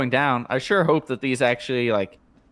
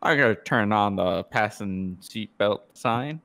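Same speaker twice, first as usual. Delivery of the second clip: slow, lower airline captain voice; pilot announcement; 5 semitones lower, 5 dB quieter, slower than usual. Pretend. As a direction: drawl it like a cabin announcement. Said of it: "You sound like a pilot".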